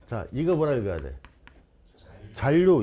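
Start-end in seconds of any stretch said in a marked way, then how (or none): 2.3-2.8 잔류 said as "잘류"; ㄹㄹ pronunciation. Shown as a demonstration. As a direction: say 잔류 with a long double L. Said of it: "이렇게 읽으란 말이야".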